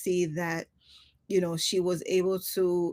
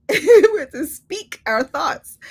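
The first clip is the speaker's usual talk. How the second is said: laughing; 9 semitones higher, 12 dB louder, slower than usual. Real, not acted.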